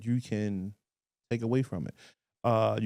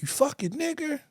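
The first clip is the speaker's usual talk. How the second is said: retold slur; 4 dB quieter than usual. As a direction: spit it out like the angry stranger, voice jumping up. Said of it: "he was like".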